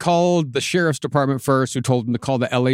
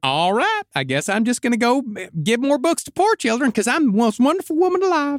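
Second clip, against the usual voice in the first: high, sweet Southern voice; high-pitched Southern belle impression; 8 semitones higher than usual. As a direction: lift it into a honeyed Southern twang. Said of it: "sweet Southern voice".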